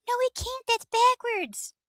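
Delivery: insist, mock-offended; indignant retort.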